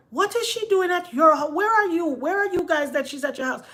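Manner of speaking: high, loud, rattled questioning; imitated indignant alarm. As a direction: imitate a mother firing off disbelieving questions. Mother demands to know, retold.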